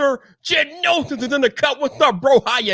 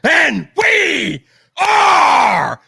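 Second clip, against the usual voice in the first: hype shout; slower than usual. Real, not acted. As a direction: blast it like a hype man opening the show. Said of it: "don't blow you guys' ears off tonight".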